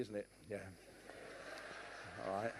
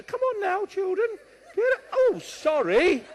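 put-on very high, loud singsong to children; hammed-up harmless parent coaxing the kids; mock-sweet parent; 9 dB louder than usual. Joking sweet parent for social workers.

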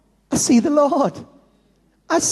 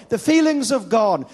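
ordinary delivery

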